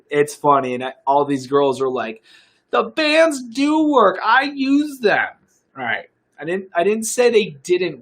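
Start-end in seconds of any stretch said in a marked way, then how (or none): 2.7-5.3 high, slow mock girly voice; a lightly hammed-up valley girl. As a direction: imitate the girls sweetly insisting. Mocking girls' comments on booty bands.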